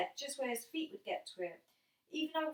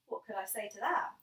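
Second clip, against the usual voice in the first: plain reading voice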